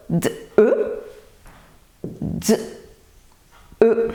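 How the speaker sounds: D and E as separate sounds; D, pause, E, each isolated; robot talk. Shown as a demonstration. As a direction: sound out D, E one at a time. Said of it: "la redit en langage robot".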